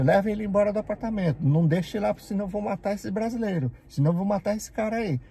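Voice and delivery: high, heated order with a threat; retold angry threat. Speaker retelling ordering the boss to remove the coworker, high and heated. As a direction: get him out of here or else. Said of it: "eu falei pro Tantocha".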